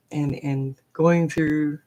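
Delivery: ordinary talk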